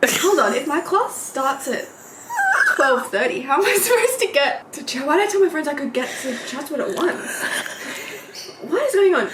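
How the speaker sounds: rising, flustered retelling of a panic; mock panic, voice climbing high; panicked realisation. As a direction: act out the sudden panic, half laughing. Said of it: "And I'm going".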